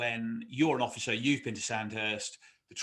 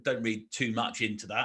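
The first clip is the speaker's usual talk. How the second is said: ordinary talk, nothing marked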